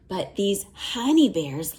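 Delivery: ordinary delivery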